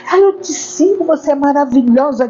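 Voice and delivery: high-pitched imitation of an adoring follower; mock gushing fan. Mimicking followers who gush over her, high mock adoration. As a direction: ham up a starstruck fan's "ai".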